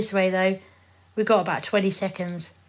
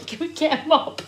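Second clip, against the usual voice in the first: giggling; 8 semitones higher than usual. Real, not acted. Laughing request.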